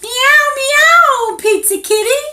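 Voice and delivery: very high, loud kitty meows; playful cat meows. Acted. To the child, voicing the kitty's greeting, playful. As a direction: meow like a little cat, then greet.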